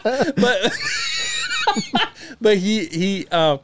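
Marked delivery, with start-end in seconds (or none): 0.4-2.4 high howling burst of laughter; shrieking laugh. Real, not acted. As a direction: let a squealing laugh burst out. Cracking up at the joke.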